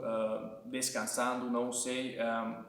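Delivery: normal talking voice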